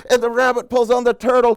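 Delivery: high, loud, driving narration; excited climax. A narrator's reading. Chain of animals jolts, excited high narration.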